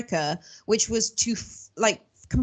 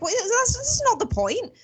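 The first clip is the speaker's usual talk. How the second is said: indignant retort; 9 semitones higher than usual. Retold or imitated. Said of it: "I'm just like".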